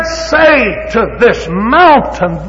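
very slow, high and loud; slow preaching. Acted. Preacher quoting scripture with drawn-out force. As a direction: stretch out mountain. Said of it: "He said".

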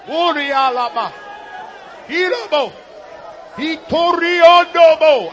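speaking in tongues. Real, not acted.

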